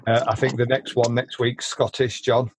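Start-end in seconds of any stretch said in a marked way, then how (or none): none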